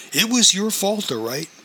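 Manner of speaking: sharp, high furious retort; angry. A narrator's reading. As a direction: spit it back at her. Furious wife confronts cousin.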